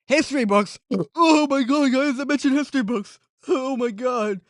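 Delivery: loud, high, thrilled exclamations; gasping, high-pitched excitement; excited outburst. Real, not acted. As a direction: burst out as if you just got a shout-out. Thrilled at being mentioned.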